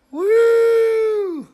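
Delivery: long, very high woo; loud high whoop. Real, not acted. Vlogger cheering pointy eyes, high whoop.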